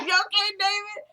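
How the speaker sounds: high, loud, laughing squeal of approval; amused hype reaction. Retold or imitated. Fan reacting to David's bold answer, amused high squeal. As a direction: whoop it through a laugh.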